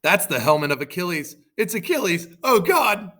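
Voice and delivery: loud, high cry climbing to dismay; hammed-up rising alarm; alarmed shout. Trojans recognise Achilles, loud shout of rising alarm. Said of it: "The Trojans shouted".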